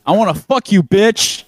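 hammed-up rude come-on; crude customer.

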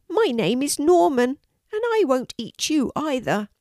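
put-on high childlike dragon voice; friendly little dragon. A narrator's reading. Norman introduces himself to Kelty, friendly little dragon.

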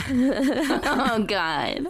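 high giggling disbelief; laughing. Real, not acted. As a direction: laugh through it. Laughing at the upcoming reveal.

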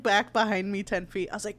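ordinary talk

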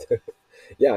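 ordinary delivery

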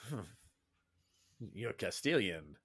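slow, low, lightly voiced suspicious guard. Guard squints at the Castilian sailor, slow and low. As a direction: size her up slowly.

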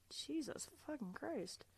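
very soft, high, slow exclamation; real hushed shock, drawn out; appalled hush. Real, not acted. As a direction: swear softly in horrified disbelief. Appalled reaction to gruesome story.

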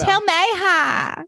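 high and slow greeting; slow high mock greeting. Mimicking the message back.

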